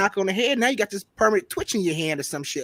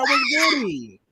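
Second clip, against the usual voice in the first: hype greeting; 8 semitones higher, slower than usual. Pretend. Signature greeting sign-off.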